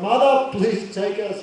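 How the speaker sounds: put-on stretched, urgent child's appeal; weeping plea. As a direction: imitate children begging mother. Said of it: "Krishna Balaram weeping".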